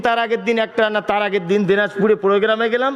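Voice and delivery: ordinary talk, nothing marked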